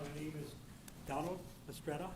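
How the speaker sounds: barely audible, soft and far from the mic; mumbled softly, away from the mic; too quiet. Real, not acted. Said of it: "If you could speak up".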